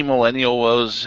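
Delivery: normal talking voice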